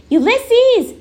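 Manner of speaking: shouting a name. A narrator's reading. Tootie searching the dark woods for the squirrel, shouting. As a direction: call out high for someone lost. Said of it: "shouted Tootie".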